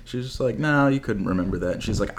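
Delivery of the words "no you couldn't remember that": deadpan mom imitation; robotic, unimpressed denial; emotionless. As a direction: say it evenly, with no surprise at all. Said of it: "There was no emotion coming from".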